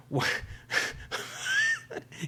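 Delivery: high airless burst of laughter; a quick silent-ish laugh at herself; breathy laugh. Real, not acted. Vlogger laughing at her own dentures joke, airy laugh.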